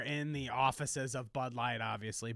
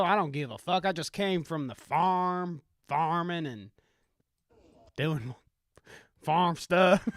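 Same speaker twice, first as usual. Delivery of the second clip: put-on Southern country accent; 4 semitones higher, 4 dB louder than usual. Farm boy boasting of homegrown folk, Southern country accent. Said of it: "country accent and my rendition of a Southern".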